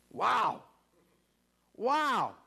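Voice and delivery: usual speaking voice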